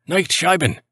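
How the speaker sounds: high-pitched call at moderate volume; voice behind door. A narrator's reading. Nurse calling from behind a door about to be blown, high plea. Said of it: "a voice from behind the door".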